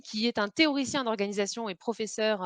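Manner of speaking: ordinary talk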